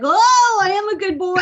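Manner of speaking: the dog who can't contain himself; dog reacting to praise, squealing with excitement; thrilled dog voice. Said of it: "he's like".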